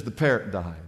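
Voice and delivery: low, subdued sad news; imitating a saddened pet owner. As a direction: break the bad news sadly, voice dropped low. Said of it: "she's sad".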